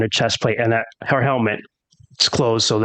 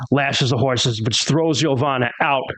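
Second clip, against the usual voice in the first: ordinary talk, nothing marked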